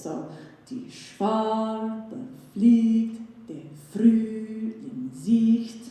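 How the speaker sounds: "die Schwalbe fliegt, der Frühling sieht" very slow, each second syllable leaned on; iambic stress shown. Shown as a demonstration. Lecturer shows the audience the iambic beat, slow and stressed. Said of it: "unstressed, stressed syllables".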